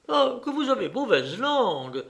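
protesting aunt, pitch swooping; Clarisse. A narrator's reading. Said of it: "s'écriait la tante Clarisse".

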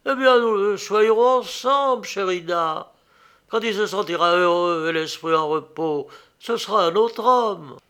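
high, loud, confident promise; eager reassurance. A narrator's reading. Suitor promises to cherish her father too, eager reassurance. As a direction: sweep her worry away brightly.

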